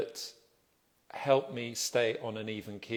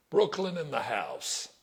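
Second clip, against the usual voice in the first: a hammed-up, failed Brooklyn accent, slower; bad Brooklyn accent; 4 semitones higher than usual. Greeting Brooklyn viewers in a bad Brooklyn accent. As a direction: try a Brooklyn accent and miss. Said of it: "Bad. Not a Brooklyn accent".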